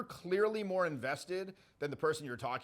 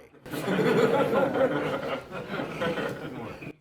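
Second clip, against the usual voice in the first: big real burst of laughter; loud laugh; 6 dB louder than usual. Real, not acted. He laughs hard at the listener's answer.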